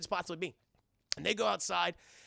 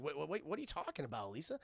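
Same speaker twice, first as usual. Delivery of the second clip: low, soft, quick confusion; Goliath; 4 semitones lower, 8 dB quieter, faster than usual. Retold or imitated. Goliath puzzled by Elisa's hug, lightly acted confusion. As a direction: lightly imitate a puzzled low-voiced hero. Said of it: "And he goes like".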